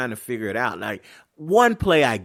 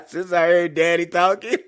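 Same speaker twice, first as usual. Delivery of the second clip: punchline cracked up with laughter; laughing through words; 7 semitones higher, 6 dB louder than usual. Real, not acted. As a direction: say it while still laughing.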